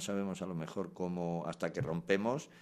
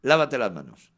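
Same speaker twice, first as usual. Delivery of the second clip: much louder, higher command; parent's order; 5 semitones higher, 13 dB louder than usual. Retold or imitated. Parent ordering a child to wash hands, loud command. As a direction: call the order across the house. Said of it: "pues sí que es verdad".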